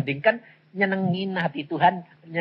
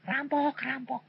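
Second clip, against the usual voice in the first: high, hushed pretend call for help; stifled imitation of crying out to the neighbours; mock yelling; 6 semitones higher, 10 dB quieter, slower than usual. Preacher retelling his call to neighbours about robbers, softened mock yell. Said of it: "teriak-teriak tetangga".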